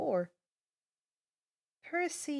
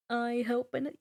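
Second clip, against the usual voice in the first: usual speaking voice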